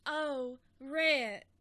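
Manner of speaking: fainting Southern belle; deep south accent. Pretend. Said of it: "let me do my Scarlett O'Hara".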